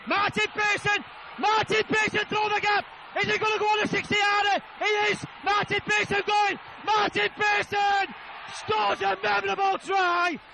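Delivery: shouting, building as the runner goes clear; excited shout. Real, not acted. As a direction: yell him all the way to the line. Sixty yard try commentary.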